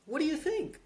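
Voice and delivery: "What do you think?" rises very high at the end; upward inflection. Shown as a demonstration. Salesman pressing a prospect, sharply rising tune. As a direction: swing "think" up high like a question. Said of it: "a upward inflection".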